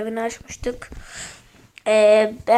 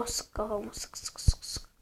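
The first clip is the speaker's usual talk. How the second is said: whisper; 9 dB quieter than usual. Real, not acted. Aiming at enemies.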